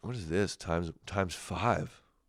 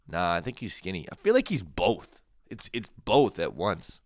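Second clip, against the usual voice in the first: ordinary delivery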